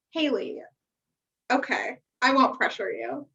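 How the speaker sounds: normal talking voice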